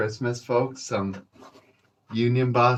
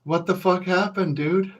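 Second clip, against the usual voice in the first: higher, startled what the fuck; mock alarm at a beaten buddy; shocked coworker; 7 semitones higher than usual. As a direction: coworkers at the hospital ask in higher-pitched shock. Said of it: "visit and go".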